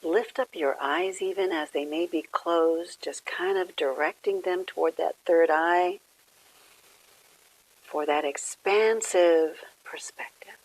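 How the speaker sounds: gentle phrases spaced by long silences; guided meditation. Real, not acted. Leads a quiet meditation.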